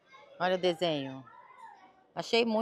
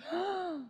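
sharp high gasp of delight; surprised gasp; 10 semitones higher, 5 dB quieter than usual. Real, not acted. Turning the jacket to show viewers its back, delighted gasp. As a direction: gasp as if you just spotted a treasure.

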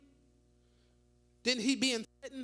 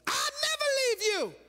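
Peter's vow; 12 semitones higher, 3 dB louder than usual. Retold or imitated. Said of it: "Here we go".

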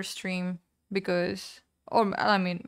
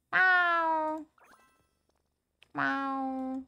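cat meow; 6 semitones higher than usual. Pretend. Meowing at cat in game.

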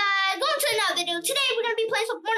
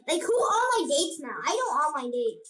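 normal talking voice

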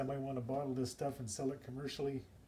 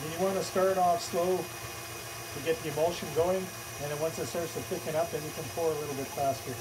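raised voice over a running mixer; louder, carrying demonstration voice; talking louder over machine; 5 dB louder than usual. Real, not acted. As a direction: project louder to be heard over the motor. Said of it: "I'll try to talk a little bit louder".

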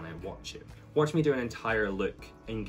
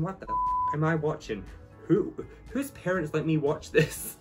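shocked disbelief; 6 semitones higher, 3 dB louder than usual. Retold or imitated.

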